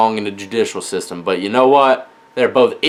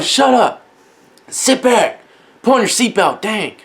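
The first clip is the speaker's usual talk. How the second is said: put-on higher, scolding the kids; irritated scolding; 8 semitones higher than usual. Dad scolding his kids in the car: higher irritated snap. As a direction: bark it at the back seat.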